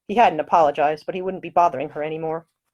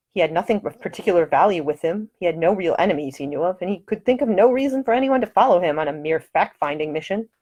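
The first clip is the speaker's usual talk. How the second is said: ordinary delivery, nothing marked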